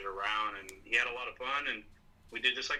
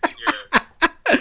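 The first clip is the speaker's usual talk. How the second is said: laughing tease; 14 dB louder than usual. Real, not acted. Teasing about swim trunks.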